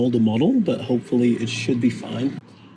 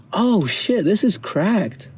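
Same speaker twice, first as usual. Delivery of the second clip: dismayed surprise; 7 semitones higher, much slower than usual. Real, not acted. Discovers broken part.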